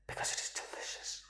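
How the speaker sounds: whispered aside; husband whispering about his wife's cooking. Real, not acted. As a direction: whisper it so the wife won't hear. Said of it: "whispered aside about wife's cooking".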